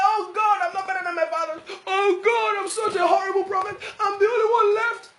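high, loud mock wail; hammed-up tearful lament to God; whining weakling. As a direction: wail like a self-pitying victim. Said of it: "he began to speak like a weakling".

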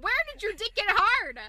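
loud, high disbelieving shout; incredulous yell. Retold or imitated. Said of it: "And I'm like".

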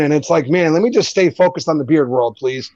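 ordinary talk, nothing marked

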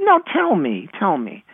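put-on high kid's plea, dropping on the repeat; pleading child. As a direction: beg like a little kid who must know. Child self pleads, retold.

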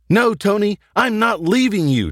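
high-pitched, defiant acted yell. A narrator's reading. Tracy refuses to abandon Tony in the fight, high acted yell. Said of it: "Tracy yelled".

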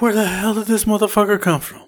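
high, startled retold exclamation; incredulous shriek of shock; spooked driver blurts out in disbelief. Truck nearly hits his car.

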